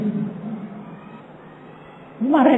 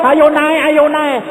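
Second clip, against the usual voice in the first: crowd cheering; 8 semitones higher than usual. Retold or imitated. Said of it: "Bukan masalahnya".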